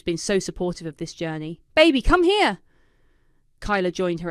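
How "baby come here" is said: loud, high call across the room. A narrator's reading. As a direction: call him over excitedly. Paisley calls Kyler over.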